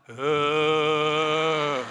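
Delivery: long, loud, level ghostly drone; drawn-out moan to scare someone; mock spooky moan. Preacher teasing frightened disciples, loud mock ghost moan. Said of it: "We'd have been like".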